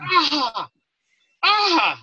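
high, loud wordless shout; imitated roar of a division; mock crowd yell. Whole division picking second worst accent, mock yell.

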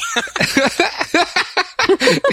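helpless high giggle; real giggle fit. Real, not acted. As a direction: giggle you can't stop. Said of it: "Why are you still laughing".

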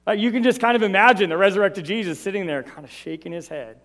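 ordinary delivery

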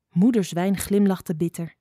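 plain reading voice

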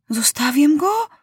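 put-on unsure boy asking shyly; timid question. A narrator's reading. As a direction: ask timidly, pitch climbing at the end. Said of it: "spytał Maciuś nieśmiało".

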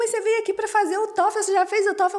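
perguntas rápidas e bem agudas; incredulidade imitada. Retold or imitated. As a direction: imite alguém pasmo, em voz aguda. Chinês pergunta, espantado com a carta. (espanto imitado, agudo e apressado)

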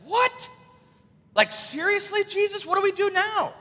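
high, incredulous disciples' outcry; exasperated, high-pitched "what now" protest; mock disbelief. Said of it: "You know they had to be like".

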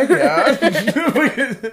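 high laughing yes; laughing agreement; laughing yeah. Real, not acted. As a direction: cackle out the yeah. Mock proud agreement, laughing.

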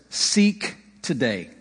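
usual speaking voice

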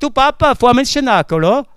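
put-on small son begging his father to go to Cenacolo, childlike; little boy asking. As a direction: plead like a small boy tugging at dad. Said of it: "der Kleine sagt".

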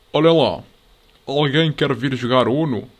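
loud, slow put-on rough voice asking a question; mock gruff Santarém girl. Santarém girls asking the lads to play Uno, loud rough mock voice. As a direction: boom it out like a rough beach girl. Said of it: "com aquela voz grossa".

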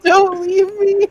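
lightly high, slow imitated plea; mock wail. Friend repeats the lost girl's plea, light mock wail. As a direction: echo the whiny begging playfully.

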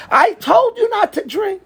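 high shouted scolding; retold yelling father. Father yells at his kid in the ambulance, shouting high. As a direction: yell it at your injured son. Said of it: "screaming at his kid".

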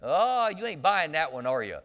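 usual speaking voice

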